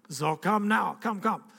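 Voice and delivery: imitated German preacher urging, pitch climbing; thick German accent. Evangelist calling him forward, urgent German accent. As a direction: put on a German accent and beckon insistently. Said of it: "he's got this thick German accent".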